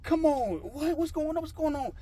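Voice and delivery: high-pitched, bewildered pleading; retold exasperated disbelief. Failed applicant asking what happened, high exasperated disbelief. Reacting to the rejection.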